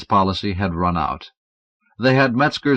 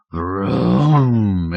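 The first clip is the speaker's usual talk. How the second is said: rumble. Acted. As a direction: rumble it like a beast. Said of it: "it rumbled".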